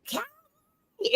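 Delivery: high sing-song call across the room. Retold or imitated. Calling cowboy across lobby.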